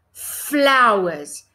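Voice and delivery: Flowers with its opening f leaned on, held and clear; f in Flowers stressed. Shown as a demonstration. Teacher repeating the flashcard word so children hear the f, f drawn out. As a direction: stretch the f of Flowers so kids hear it. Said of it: "Listen to it again".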